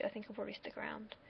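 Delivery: hushed, quick whisper to himself; whispered aside. Real, not acted. Vlogger deciding to stay on the app, whispering. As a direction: drop to a breathy whisper, fast.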